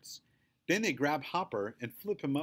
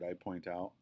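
ordinary delivery

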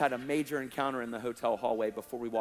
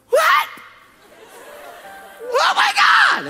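piercing, high shriek of shock; imitated scream; 14 dB louder, much slower than usual. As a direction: scream it, stretching the last words. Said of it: "and screamed at me".

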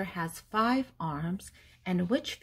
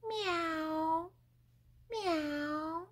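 high mewing cat sound; cat meow; 10 semitones higher, 4 dB quieter than usual. Shown as a demonstration. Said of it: "the most common one is".

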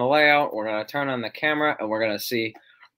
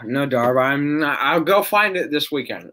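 ordinary delivery

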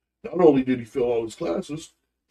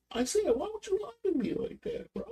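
hurt confrontation; 13 dB quieter than usual. Retold or imitated. Father confronts lying son.

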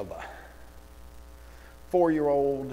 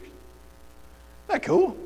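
sarcastic wonder; 13 semitones higher than usual. Acted. Mocking the textbook photos to students, sarcastic high wonder. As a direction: gush with fake amazement.

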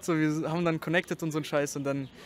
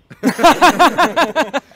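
high, loud, rattling belly laugh; 8 semitones higher, 14 dB louder than usual. Real, not acted. Bursts out laughing after introduction.

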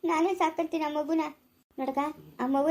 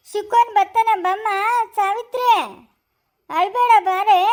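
higher and louder call; 5 semitones higher, 8 dB louder, slower than usual. Acted. Elder calling crying Savitri to come, loud and high. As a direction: call her over across the house.

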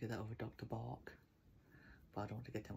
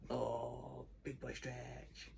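cooing to a pet; 3 dB quieter, much slower than usual. Real, not acted. Cooing at stretching dog.

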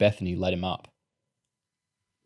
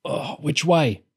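strained, higher-pitched groan; 6 semitones higher, slower than usual. A narrator's reading. Boy struggling with the stretcher, groaning. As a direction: groan it under a heavy load. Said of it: "Rat groaned".

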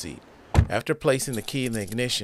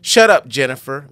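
loud angry yell; furious shout at her friend; 2 semitones higher, 11 dB louder than usual. Acted. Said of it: "Megan yells".

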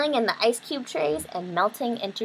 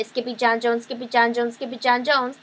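quick repetition; 3 semitones higher than usual. A narrator's reading. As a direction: rattle the name off rapidly. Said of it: "Quicker than you can say".

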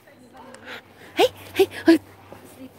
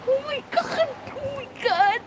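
much higher, breathless squeals of joy; overjoyed squeal; 11 semitones higher, 4 dB quieter than usual. Real, not acted. Finally seeing the wombat, squealing with joy. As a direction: squeal like a dream came true.

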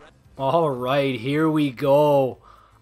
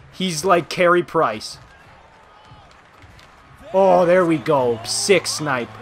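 ordinary delivery

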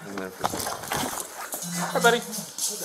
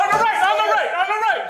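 urgent repeated call, higher; warning shout; 6 semitones higher, faster than usual. Real, not acted. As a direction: warn your partner fast. Officer warning partner mid-entry.

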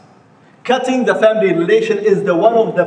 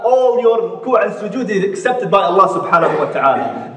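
usual speaking voice

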